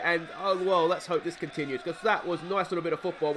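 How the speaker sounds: ordinary delivery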